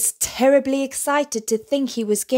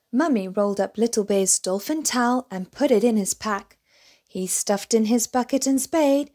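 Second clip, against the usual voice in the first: usual speaking voice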